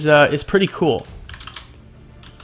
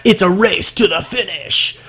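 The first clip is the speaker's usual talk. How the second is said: higher, put-on dramatic announcer voice; mock race announcer; 4 semitones higher than usual. Gamer fleeing a creature announces the race to the finish, mock-dramatic. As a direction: call it like a race climax.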